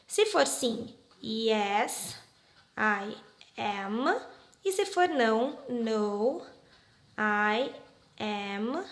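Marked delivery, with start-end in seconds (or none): none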